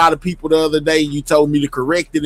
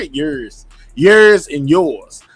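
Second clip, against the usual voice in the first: slow drawled Texan "Yours and yours"; Texan accent; 3 semitones lower, 5 dB louder, much slower than usual. Shown as a demonstration. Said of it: "I love y'all Texans accent".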